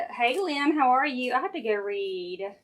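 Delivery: ordinary talk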